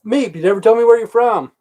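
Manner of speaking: high, loud call to a viewer; loud callout. Real, not acted. Greets a livestream viewer named Meep, high and loud. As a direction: shout the question up high.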